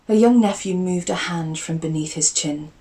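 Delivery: ordinary delivery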